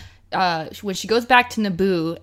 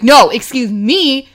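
firm mock rebuke; 4 semitones higher, 9 dB louder, slower than usual. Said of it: "She's like".

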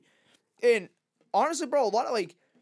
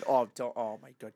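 normal talking voice

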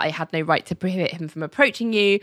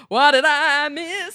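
cheery John; 8 semitones higher, slower than usual. Retold or imitated. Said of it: "being like".

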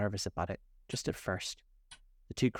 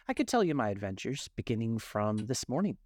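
plain reading voice

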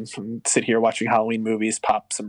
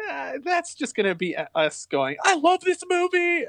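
mock gushing quote; 13 semitones higher than usual. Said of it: "it was like".

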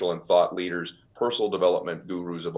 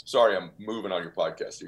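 usual speaking voice

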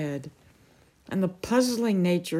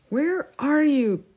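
raised, high-pitched call; 10 semitones higher, 5 dB louder than usual. A narrator's reading. King searching for his hidden opponent, calling out. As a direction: call out to find your opponent.

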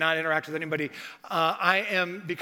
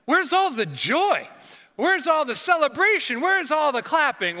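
exasperated outcry; 8 semitones higher, 6 dB louder than usual. Retold or imitated. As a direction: cry out where's the celebration. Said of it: "and I was like".

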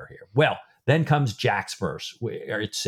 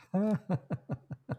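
soft, higher-pitched chuckling; acted chuckle; 9 semitones higher, 8 dB quieter than usual. A narrator's reading. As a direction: chuckle quietly like an amused helmsman. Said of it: "can't help but chuckle a little".

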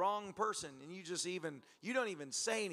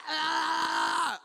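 long, loud high scream; sustained hammed-up shriek; mock screaming; 9 semitones higher, 8 dB louder than usual. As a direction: scream all the way down the hill. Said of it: "Screaming the whole way".

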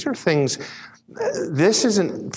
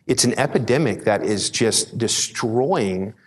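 usual speaking voice

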